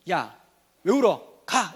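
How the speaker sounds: mock scolding in short bursts; unkind father. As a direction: play a dad loudly ordering a crying child. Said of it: "이렇게 하지 않고". (우는 아이를 다그치는 아빠 흉내)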